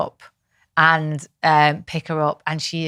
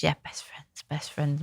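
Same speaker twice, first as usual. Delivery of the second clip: whispered, much softer; 12 dB quieter than usual. Retold or imitated. Daughter whispering to mum in a cuddle, whispered. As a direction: breathe it like a shy child. Mother retells daughter's reply.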